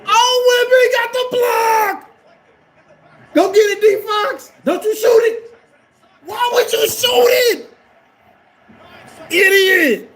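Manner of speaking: high, full-force shout at the game; outraged fan screaming. Real, not acted. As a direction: scream at the player in disbelief. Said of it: "I'm screaming at him".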